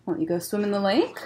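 plain reading voice

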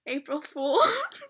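drawn-out giggly April Fool's; laughing reveal. Real, not acted. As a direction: burst into laughter as you reveal the prank.

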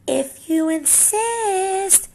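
high, very slow, smug drawl; sly skunk. Acted. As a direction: savor the threat of the tail. Skunk accepts.

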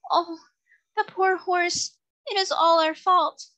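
put-on high, slow, grieving young girl; pitiful girl. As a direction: cry out in pity and guilt. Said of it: "that sweet, pitiful voice saying".

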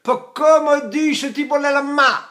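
high, loud dialect outburst; Italian dialect. Shown as a demonstration. Teacher shows Italians speaking dialect, high loud exclamation. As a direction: call it out in spoken Italian dialect. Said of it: "when they drop and speak dialect".

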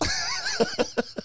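high burst of real laughter; laughing at his own punchline; big laugh. Real, not acted.

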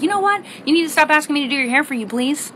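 high, fast exasperated retort; fed-up daughter. Retold or imitated. Daughter telling mom off, high and fast. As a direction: snap it back, done with it. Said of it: "And I'm like".

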